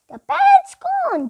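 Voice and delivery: high, alarmed cry that the parrot is gone; shouted alarm. A narrator's reading. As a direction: call out in a panic, pitched high. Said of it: "shouted Dolly".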